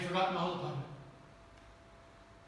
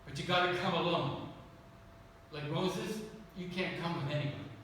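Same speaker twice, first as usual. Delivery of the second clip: lower, calm, quietly firm voice of God; lightly voiced Lord, low and steady; gentle inner voice of the Lord; 5 semitones lower, 6 dB quieter than usual. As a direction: speak as God calling him up the mountain alone. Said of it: "I sensed the Lord saying".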